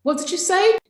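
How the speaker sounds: high, loud call from indoors. Acted. Wife calling from the house, loud and high. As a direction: shout the question through the wall.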